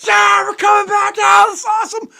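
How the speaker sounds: very high, loud jubilant shout; ecstatic cheering. Retold or imitated. Two fans running around the bar cheering the comeback, ecstatic. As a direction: cheer the comeback at full tilt.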